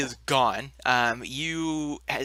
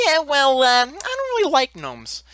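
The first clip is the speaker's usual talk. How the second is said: high, louder sulky giant; pitched up and grumpy about gnomes; 9 semitones higher, 5 dB louder than usual. Acted. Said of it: "he says".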